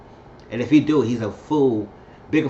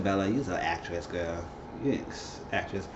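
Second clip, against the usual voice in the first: under-breath aside; 6 semitones lower, 10 dB quieter than usual. Real, not acted. Aside mocking Bella.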